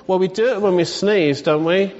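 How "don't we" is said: normal talking voice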